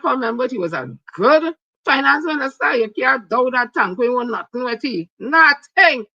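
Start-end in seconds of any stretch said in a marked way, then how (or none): 5.2-6.0 loud emphatic refusal. Real, not acted. Rejecting the candidate outright, loud and high. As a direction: shout the word down, high and loud.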